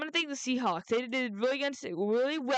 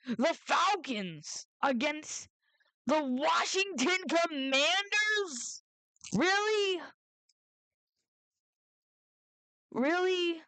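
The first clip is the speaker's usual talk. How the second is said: incredulous; 6 semitones higher, much slower than usual. Real, not acted. Incredulous at matchup.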